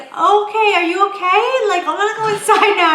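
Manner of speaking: high, loud, concerned but eager to leave; imitating the girl. Girl checking on the fallen stranger, high and loud. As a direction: sound awkwardly concerned and keen to go. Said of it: "she's like".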